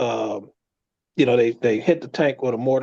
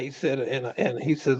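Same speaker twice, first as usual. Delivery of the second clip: ordinary talk, nothing marked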